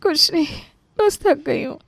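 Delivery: high-pitched, pained little voice; tearful. Acted. Anita hides her back pain from questioner, tearful. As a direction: brush off the question through tears. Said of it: "वो दर्द के मारे रोने लगी".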